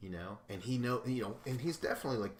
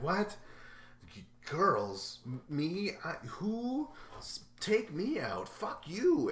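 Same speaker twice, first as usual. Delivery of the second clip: much higher, stammering indignant outburst; outraged Camilla; 8 semitones higher, 3 dB louder, slower than usual. Retold or imitated. Camilla hearing the girls plan to target her, sputtering outrage. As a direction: sputter in disbelief then snap.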